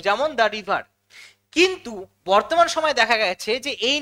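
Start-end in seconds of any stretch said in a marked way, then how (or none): 0.4-0.8 "the" pronounced daa, leading into "river"; "daa" before consonant. Shown as a demonstration. Teacher's example before a consonant sound, "the" said as daa. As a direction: say "the river" with an open a in the article. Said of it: "দা উচ্চারণ করব".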